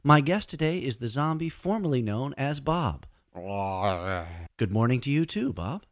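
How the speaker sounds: mock talk show host; host a silly radio show. Fake radio host interview.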